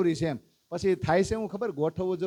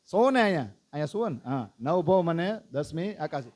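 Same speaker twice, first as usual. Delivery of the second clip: usual speaking voice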